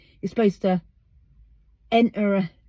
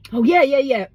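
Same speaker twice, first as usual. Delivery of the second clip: excited; 5 semitones higher, 6 dB louder than usual. Real, not acted. Reacting to something off camera.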